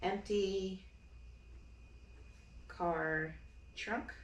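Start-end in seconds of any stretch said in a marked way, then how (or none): none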